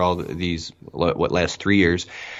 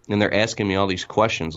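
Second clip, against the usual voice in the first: usual speaking voice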